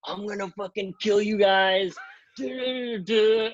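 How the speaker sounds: thief's mock rage, ending in a shout; mock furious threat. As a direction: imitate the enraged seller shouting threats. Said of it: "he's so mad".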